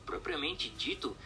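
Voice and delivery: ordinary talk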